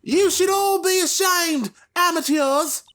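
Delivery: put-on high, firm Scottish accent; proud kid with Scottish accent. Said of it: "So you're Scottish now".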